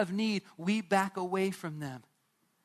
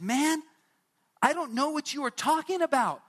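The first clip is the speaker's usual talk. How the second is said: much higher and louder, fed up; exasperated denial; 7 semitones higher, 4 dB louder than usual. Acted. Peter snapping at the third accuser that he doesn't know Jesus. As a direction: throw it back at the accuser, annoyed.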